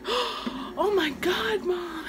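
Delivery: put-on slow, high amazed child. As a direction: draw it out in wonder. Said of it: "She was like".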